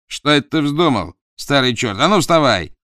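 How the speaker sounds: high, loud bullying order; bully the old man up; rough command. Acted. Dot bullies his father.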